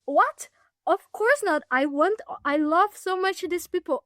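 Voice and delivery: high, loud, shocked protest; incredulous. Retold or imitated. Speaker rejecting her own judgment, high and incredulous. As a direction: recoil at the very idea. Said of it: "I'm like".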